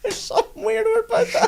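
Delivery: very high, squeaky laugh-talk; barely getting it out for laughing; helpless laughing. Real, not acted. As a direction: squeal the words through real laughter. Cracking up over names.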